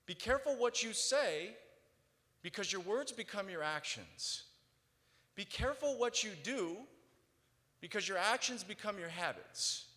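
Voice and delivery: loud, high, measured recitation; reading the ceiling quote out as a ringing proclamation; declaiming a quote. Acted. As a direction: proclaim each line like a creed. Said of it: "It says".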